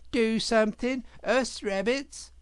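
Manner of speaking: silly rabbit. Acted. The scared rabbit answering Otter, startled and silly. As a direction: squeak out the dim reply.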